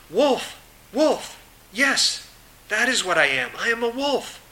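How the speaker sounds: suddenly remembering, bursting with joy; excited wolf. Acted. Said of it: "she became excited with the news".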